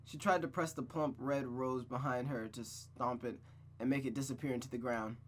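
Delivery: ordinary delivery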